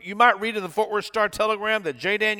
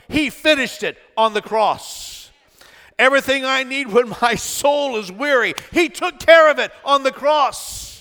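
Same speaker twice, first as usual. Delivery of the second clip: higher, driving, rhythmic pulpit build; full-force preaching; 6 semitones higher, 4 dB louder than usual. Real, not acted. Preacher listing what the cross took care of, full-force preaching. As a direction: pile up each refrain with mounting fervour.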